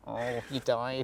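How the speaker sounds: lightly voiced game narrator; soft, low mock game-over line. Imitating the game announcing a death, hushed narrator voice. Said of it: "it's the voice of the game".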